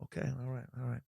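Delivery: very soft, reluctant mumble; hushed, resigned celebrity impression; De Niro. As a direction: give in under your breath. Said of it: "And De Niro goes".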